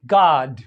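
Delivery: गॉड as "gaad", long open unrounded AH; American open O. Shown as a demonstration. Teacher demonstrating American गॉड to students, long open AH. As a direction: open the vowel of गॉड like the first vowel of father. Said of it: "American ओ में".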